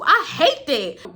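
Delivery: slow, laughing complaint. Real, not acted. Laughing at own anxiety.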